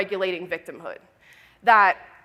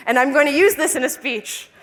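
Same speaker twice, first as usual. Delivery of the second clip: higher, laughing as she tells it; real amused laughter; 7 semitones higher, 3 dB louder than usual. Real, not acted. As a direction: laugh through the punchline. Laughing at own reply.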